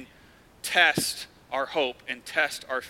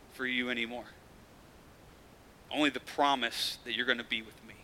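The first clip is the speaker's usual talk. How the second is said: soft comforting Jesus; 3 semitones lower, 6 dB quieter than usual. Retold or imitated. Jesus voiced comforting believers.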